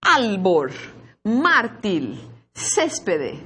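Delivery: "Albor, mártil, céspede" each punched on the first syllable, then falling; stress on penultimate syllable. Shown as a demonstration. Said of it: "são palavras llanas ou graves".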